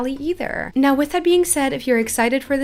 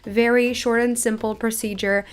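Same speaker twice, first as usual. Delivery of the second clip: normal talking voice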